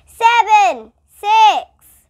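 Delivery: high, loud countdown; shouted countdown. A narrator's reading. Principal shouting through cotton balls, loud countdown. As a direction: bellow each number. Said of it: "had to shout the numbers".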